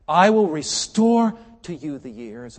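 loud, high, slow scripture promise; emphatic proclamation. Retold or imitated. Preacher reading God's promise to troubled young man, loud proclamation. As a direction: swell into a slow, ringing declaration.

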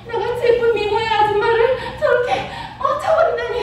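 high-pitched, acted outrage; indignant wife. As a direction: play a scorned lady trembling with anger. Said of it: "너무나 화가 난 거야". (분해서 부들부들하는 높은 목소리)

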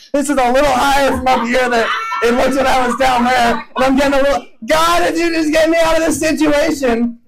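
high, climbing fear up to a shout; rising panic. Retold or imitated. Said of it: "You're like".

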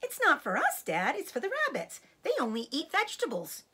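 high, insistent little fox; child explaining earnestly to Dad; smallest young fox. A narrator's reading. Smallest fox argues with his dad.

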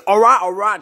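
loud, high, fed-up outburst; exasperated yell. Acted. Pestered player gives in, exasperated yell. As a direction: shout to get them off you.

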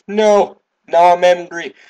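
high, loud outburst; alarmed yell. Real, not acted. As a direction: shout in dismay at the game. Game enemy turns angry.

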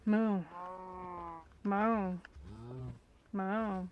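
mooing like a cow. Pretend. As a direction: moo at them. Mooing at cows in game.

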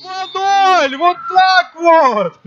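high-pitched thrilled score call; excited hockey goal call. Real, not acted. Commentator calls second goal.

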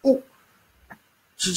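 ordinary talk

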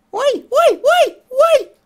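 loud, high cheer; joyful cheering. Acted. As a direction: shout it with delight. Cheers at being uncolored.

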